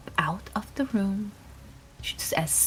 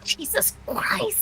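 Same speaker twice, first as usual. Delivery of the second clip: panicked whisper; 13 semitones higher, 4 dB quieter, slower than usual. Real, not acted.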